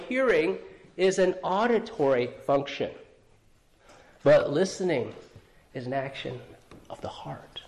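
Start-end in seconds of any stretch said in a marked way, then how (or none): 5.7-7.6 breathy reverent hush; hushed whisper. Real, not acted. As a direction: drop to a whisper on the heart. Sermon point dropped to whisper.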